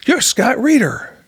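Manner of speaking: high-pitched, imitated amazement; astonished recognition. Former pupil suddenly recognizes old mentor, high amazed exclamation. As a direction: exclaim as if stunned to see them. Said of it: "his mouth falls open".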